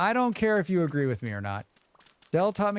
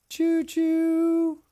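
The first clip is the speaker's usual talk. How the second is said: high-pitched "choo choo" toot; train whistle imitation; 13 semitones higher, 4 dB louder than usual. Streamer cheering on the hype train, high train-whistle imitation.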